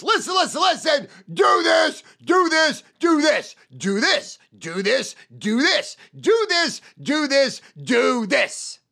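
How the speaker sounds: loud, high rapid commands; shouted listen do this. Retold or imitated. Salesman reenacting the pitch, shouting commands. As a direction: fire orders at the customer. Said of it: "So it was".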